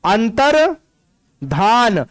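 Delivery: अंतर्धान drawn out very slowly, syllable by syllable; slow spelling demo. Shown as a demonstration. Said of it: "ध्यान नहीं होता है ये".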